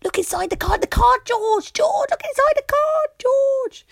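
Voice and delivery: high, loud chorus of animals; very high, loud excited animal voices. Acted. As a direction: call out eagerly like little animals.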